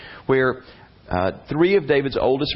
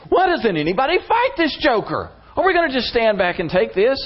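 much higher, incredulous indignant cry; appalled outrage; 12 semitones higher than usual. Retold or imitated. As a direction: throw up your hands in disbelief. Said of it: "he's just appalled".

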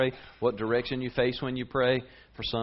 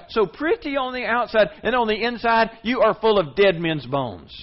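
angry denunciation; 7 semitones higher, 8 dB louder than usual. Retold or imitated. Preacher voicing Jesus denouncing the Pharisees, loud scornful rebuke. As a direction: condemn them with scorn. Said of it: "Jesus would look at them and say".

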